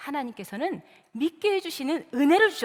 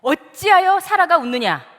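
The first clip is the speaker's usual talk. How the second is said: loud, higher-pitched scolding demand; thundering rebuke; 4 semitones higher, 8 dB louder than usual. Acted. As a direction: rebuke her suddenly in God's commanding voice. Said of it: "난데없이 호통을 치는 겁니다". (느닷없이 호통치듯)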